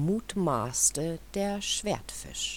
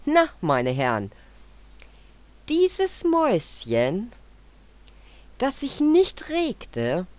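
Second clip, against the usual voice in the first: proud boast; 9 semitones higher, 6 dB louder than usual. A narrator's reading. Mouse boasting to the gentlemen.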